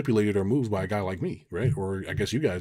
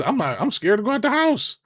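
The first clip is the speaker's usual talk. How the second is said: mock scared; 13 semitones higher, 6 dB louder than usual. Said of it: "I'm, like, scared".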